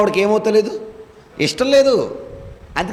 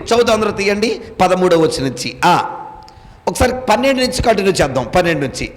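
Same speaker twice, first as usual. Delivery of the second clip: ordinary talk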